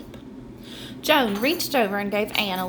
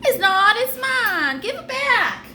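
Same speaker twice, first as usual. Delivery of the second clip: much higher, loud squabbling demand; a twin yelling at her sister over a sock; angry child; 12 semitones higher, 5 dB louder, slower than usual. Acted. Twin snaps back in quarrel.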